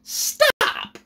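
high, pleading mock voice, last word breathy. Commentator mocking a pleading state official, high and breathy. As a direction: beg them to stop, half joking.

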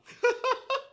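very high delighted giggle; high squeal laugh. Acted. Giggle after umbrella.

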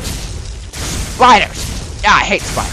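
real burst of loud dread; spider panic. Real, not acted. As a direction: yelp in alarm at spiders. Startled by spiders in game.

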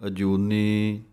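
ਅਜੂਨੀ with a clear j sound, not y. Shown as a demonstration. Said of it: "ਇਸੇ ਤਰ੍ਹਾਂ ਅਜੂਨੀ ਪਾਠ ਪੜ੍ਹਨਾ ਹੈ". (ਅਜੂਨੀ ਵਿੱਚ ਜੱਜੇ ਦੀ ਪੂਰੀ ਆਵਾਜ਼)

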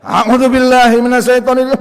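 high, shouted slow prayer; mock bellowed invocation. Shown as a demonstration. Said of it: "makin kenceng".

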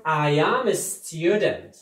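I am a student drawn out slowly, leaning on am; slow teacher's model, stress the word am; slow model sentence. Shown as a demonstration. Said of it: "آه".

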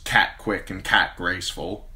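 plain reading voice

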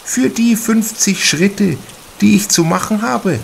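a small, worn lady brushing off worry; affected spinster. A narrator's reading. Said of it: "Aufgrund ihrer Stimme stellte Kaschudas sie sich klein und mager vor, ein wenig verbraucht, ein wenig affektiert".

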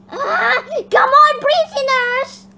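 loud, high shout to a group; rallying yell in play; calling out. Pretend. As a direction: holler it, stretching the last word. Calls out to other prisoners.

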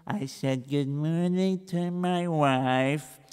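soft, slow, dragging account; slow plodding recital. Acted. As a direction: drag out every boring detail. Reb Israel plods again.

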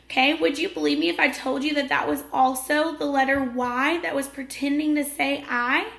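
ordinary talk

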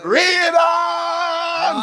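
high, loud, drawn-out preaching call; shouted command. Real, not acted. Preacher urges the scripture reader onward, long high shout. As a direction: holler it to urge the reader on.

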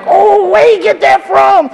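very high, drawn-out mock dismay; feigned shock. Embarrassed parent disowning what the child said, mock shocked. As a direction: act scandalized, slow and way up high.

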